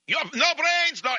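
put-on high-pitched yelling in a thick Russian accent; screaming Russian. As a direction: rant like a crazed Russian whose bumper got hit. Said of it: "he's screaming and yelling, the Russian".

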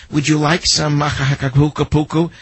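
father at an Indian buffet showing off to his daughter, mock voice; mock father explaining the food. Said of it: "And the father starts in".

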